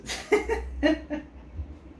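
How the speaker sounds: a real laugh breaking out, high and airy; amused high laugh; high breathy laugh. Real, not acted. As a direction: let a high laugh slip out. Laughing while telling the story.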